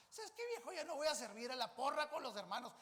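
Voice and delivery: high-pitched sabes qué, viejo, then loud quitting; fed-up wife. Acted. Wife in bed telling her husband she quits, fed up. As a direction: announce you're done with serving.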